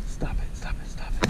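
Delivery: breathy whispered pleading at the fish; urgent whisper. Real, not acted. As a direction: hiss it so the fish won't spook. Urging fish under breath.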